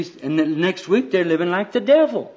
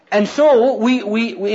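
ordinary talk

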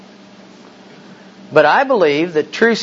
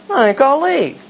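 even higher, offended announcement; escalating mock-wounded pride; huffier exit; 10 semitones higher than usual. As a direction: repeat it pitched up further. Huffy reply in retold scene.

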